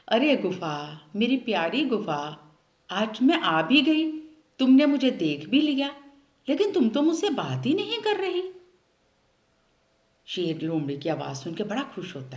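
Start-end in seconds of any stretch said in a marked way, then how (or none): 0.1-8.4 sweet coaxing. Acted. A clever fox sweetly coaxing the cave, rising higher and louder. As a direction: sweet-talk the cave to coax it. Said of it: "बड़े प्यार से बोलती है".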